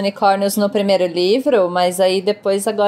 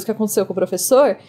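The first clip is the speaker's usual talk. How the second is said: usual speaking voice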